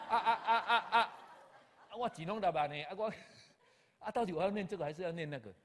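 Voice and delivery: high, loud flustered outcry with a nervous laugh; hammed-up alarm, voice jumping up and loud; retold panic. Told to study two schools, he cries out in high, loud mock panic. Told he must earn a degree.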